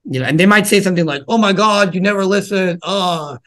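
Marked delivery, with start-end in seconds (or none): 1.3-3.4 put-on whiny child. Child to tired parent, higher huffy complaint. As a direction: sulk like a kid denied attention.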